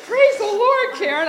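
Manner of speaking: imitating a friend's high, loud rejoicing; joyful exclamation. Chris rejoicing over the found money, imitated joyful cry. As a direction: cry out in acted delight, pitch way up.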